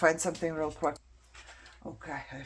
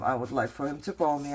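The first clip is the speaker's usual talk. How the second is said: ordinary talk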